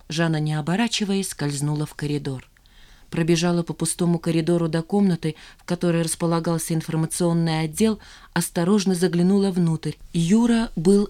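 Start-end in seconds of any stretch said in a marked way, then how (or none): none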